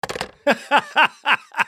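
high bursts of laughter. Real, not acted. Radio host laughing at a caller's joke, high laugh.

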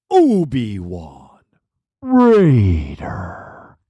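low, slow, drawn-out name call; deep announcer intro. Acted. Radio host introducing the caller Obi-Wan Raider, low dramatic announcer voice.

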